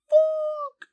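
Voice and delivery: very high, loud excited cry; overexcited squeal. Retold or imitated. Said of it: "maybe I was just so excited".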